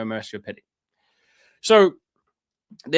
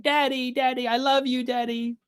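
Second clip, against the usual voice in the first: put-on high little child's voice, adoring; toddler voice; 10 semitones higher than usual. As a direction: play a toddler calling his dad sweetly. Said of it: "with his toddler who's like".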